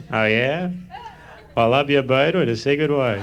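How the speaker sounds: lightly voiced Groucho quip; a hint of Groucho, sly; Groucho Marx impression. Kid ranks Horshack in a light Groucho voice. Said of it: "Does Groucho Marx ranking".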